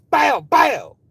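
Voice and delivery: two loud high bangs; shouted gunshot imitation. Firing into the car, shouted gunshot noises. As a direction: holler out the shots.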